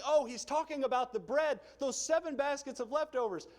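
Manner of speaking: clueless disciples. Retold or imitated. Disciples misreading Jesus' warning, mock eager realization. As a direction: play the disciples getting it wrong.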